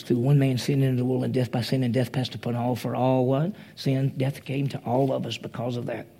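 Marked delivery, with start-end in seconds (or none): none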